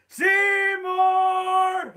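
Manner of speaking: long, high, loud sung-out call; Chalmers bellowing. Acted. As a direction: stretch the name out at full volume. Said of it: "Steamed Ham script".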